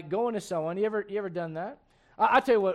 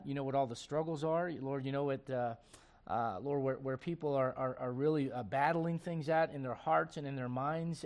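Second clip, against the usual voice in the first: low, soft, earnest prayer; 4 semitones lower, 5 dB quieter than usual. Real, not acted. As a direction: pray quietly and humbly over the congregation. Leads the congregation in prayer.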